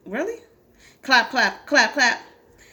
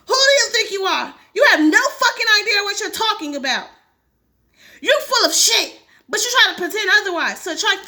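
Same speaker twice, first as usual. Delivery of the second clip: higher and louder, furious reply; angry outburst; 6 semitones higher, 3 dB louder than usual. Acted. Beatrice snapping at the group, loud and angry. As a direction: hit back in anger.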